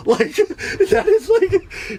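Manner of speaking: high-pitched laugh breaking through the words. Real, not acted. Laughing at his own story to listeners, real laughter.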